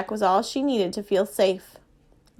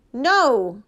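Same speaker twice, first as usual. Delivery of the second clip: firm refusal just short of a yell; restrained near-yell; almost yelling; 3 dB louder than usual. A narrator's reading. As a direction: refuse loudly, holding back from a full yell. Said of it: "Mama was almost yelling at Daddy".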